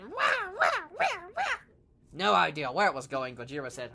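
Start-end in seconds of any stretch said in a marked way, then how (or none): 0.0-1.4 very high, far-off wailing "wah, wah"; wobbly torpedo noise. Retold or imitated. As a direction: make the crazy torpedo wail. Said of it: "and it went".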